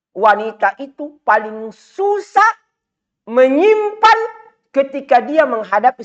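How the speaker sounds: rising preacher's fervour; fiery preaching. Acted. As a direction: preach it out high and hard to the crowd. Emphatic sermon peak.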